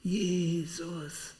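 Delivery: retold cry. Preacher retelling how he cried to Jesus in the woods, mild mock shout. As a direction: call out the name, but only as a recollection. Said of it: "Ich hab ganz laut geschrien".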